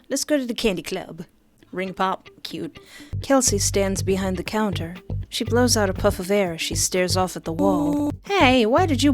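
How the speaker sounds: ordinary talk, nothing marked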